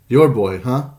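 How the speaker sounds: ordinary delivery, nothing marked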